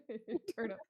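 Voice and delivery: words broken up by laughter; laughing tease. Real, not acted. Player teasing Turnip over the safe word, laughing. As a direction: tease through a chuckle.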